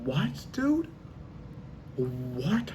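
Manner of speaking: a shocked founding father; Ben Franklin stunned. Retold or imitated. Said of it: "Ben Franklin would be like".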